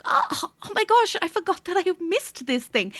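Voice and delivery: high-pitched "oh my gosh" of rediscovery; gasping, high, delighted-discovery voice; mock surprised realization. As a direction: act out suddenly finding something long forgotten. Acting out finding a lost thing.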